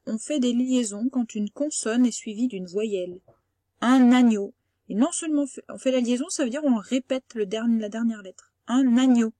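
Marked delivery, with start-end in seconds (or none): 3.8-4.5 Un agneau with the N carried into agneau, said louder; liaison demo. Shown as a demonstration. Teacher modelling the liaison for a pupil, loud. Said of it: "Ça fait n". 8.7-9.3 Un agneau with the N carried into agneau, said louder; liaison demo. Shown as a demonstration. Teacher modelling the liaison for a pupil, loud. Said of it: "Ça fait n".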